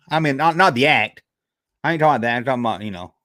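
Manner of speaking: ordinary delivery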